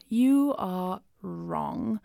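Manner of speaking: slow, deliberate, mock-stern pronouncement; mock stern verdict. Host scolding listeners who disagree about the song, slow and mock-stern. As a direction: lay down the verdict word by word.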